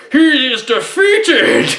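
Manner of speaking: high, drawn-out mock battle cry; hammed-up overdubbed yell, loud and stretched; bad movie ADR. Reviewer mocking a movie's dubbed line, hammed-up high yell. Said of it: "really funny bad A D R".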